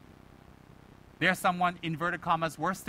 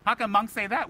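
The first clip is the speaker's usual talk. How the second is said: incredulous; 5 semitones higher, 6 dB louder than usual. Acted. Acted shocked listener questions monk's answer.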